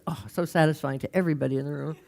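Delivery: ordinary talk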